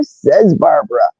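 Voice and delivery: ordinary talk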